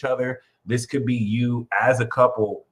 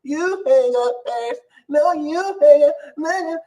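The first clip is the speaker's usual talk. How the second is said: high, squeaky sweetheart banter, climbing higher; mock lovesick couple; 14 semitones higher, 5 dB louder than usual. Tarot reader imitating sweethearts on an all-night call, mock lovesick squeal. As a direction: play-act giddy lovebirds, ever more squeaky. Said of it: "talking to each other".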